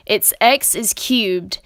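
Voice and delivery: usual speaking voice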